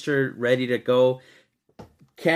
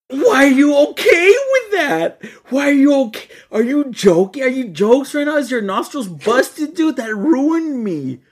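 high, loud, laughing and crying at once; laughing outraged shout; 8 semitones higher, 7 dB louder than usual. Real, not acted. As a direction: yell at him in disbelief, laughing through tears.

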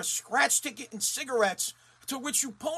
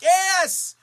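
mock cheer; 6 semitones higher, 7 dB louder, slower than usual. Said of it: "the Mass State Lottery is like".

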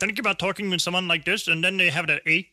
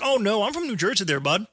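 put-on cheery Canadian accent, pitched up; 4 semitones higher than usual. Telling the cashier he's from New Jersey in a Canadian accent. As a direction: land "there, bud" like a local. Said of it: "with a Canadian accent the entire time".

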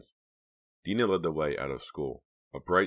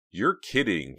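usual speaking voice

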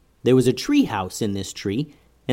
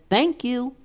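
tiny squeaky critter; 12 semitones higher than usual. Acted. Mice thank them.

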